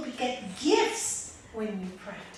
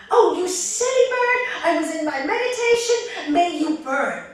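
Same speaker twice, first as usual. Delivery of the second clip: loud, high, furious curse; hammed-up angry monk cursing, much higher and louder; 8 semitones higher, 15 dB louder than usual. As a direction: shout the curse down on the bird.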